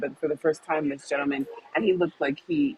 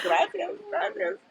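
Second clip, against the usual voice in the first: mock teasing man; 3 dB quieter, slower than usual. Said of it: "he's like".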